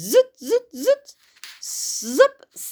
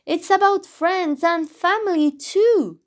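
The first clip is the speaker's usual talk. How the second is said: character voice; 8 semitones higher, 4 dB louder than usual. Acted. Max speaks.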